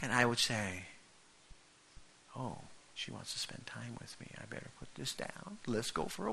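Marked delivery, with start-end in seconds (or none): 2.4-6.3 softer private realization, rising to eager; husband catching on to his wife's wish, whispered then eager; whispered thought. Retold or imitated.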